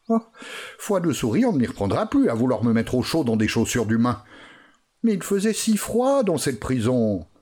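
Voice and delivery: voix de souris aiguë et vive; petite souris. Acted. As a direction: une souris soulagée qui se parle à elle-même. (la souris échappée se réjouit, voix aiguë)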